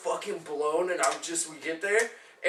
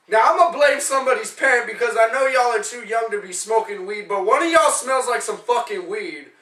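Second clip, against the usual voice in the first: loud, raised-pitch imitation of an angry teacher; mad teacher; 5 semitones higher, 7 dB louder than usual. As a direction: scold the whole class at full volume. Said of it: "he got really mad".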